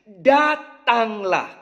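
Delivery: "Datanglah" drawn out very slowly, each syllable weighted; slow emphatic command. Retold or imitated. Preacher voicing Jesus calling Peter, slow and weighted. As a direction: stretch out "Datanglah" like a gentle but firm call. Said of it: "Kata Yesus".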